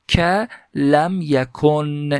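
teacher slowly voicing the Arabic example for students; slow recitation. Shown as a demonstration. Teacher to students, reading the Arabic text slowly. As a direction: read مثل ثاقبون slowly, each syllable drawn out.

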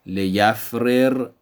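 لیفرر said slowly as "li-yaf-rir", both r sounds apart; slow, separate rr in لیفرر; لیفرر read unmerged, syllable by syllable; Arabic unmerged. Shown as a demonstration. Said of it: "به سه صورت".

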